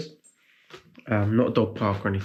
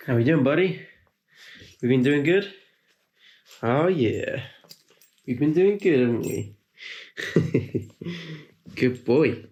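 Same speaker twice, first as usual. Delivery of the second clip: higher sing-song cooing at a puppy; 4 semitones higher than usual. Real, not acted. Owner cooing to puppy Reggie, sing-song baby talk. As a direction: coo at him like a proud owner.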